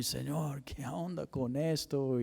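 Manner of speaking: hushed, grieving question to God; tearful plea. Retold or imitated. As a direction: pray it as if through tears, barely voiced. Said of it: "así en mi casa y llorando".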